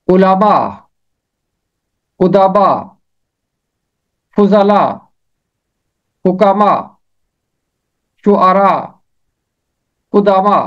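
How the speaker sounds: علماء، ادبا each with short u first, spaced; pronunciation list. Shown as a demonstration. Teacher drilling plurals like امارا. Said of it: "انہیں اس طرح پڑھا جائے گا".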